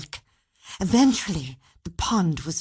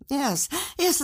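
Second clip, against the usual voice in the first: pitched-up eager agreement; eager yes; 12 semitones higher than usual. Acted. Bert warming to the idea, eager. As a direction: Bert getting keen, voice jumping up.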